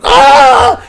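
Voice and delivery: sudden high loud mock shriek. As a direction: shriek like someone getting burned. Imitated shriek of burned people.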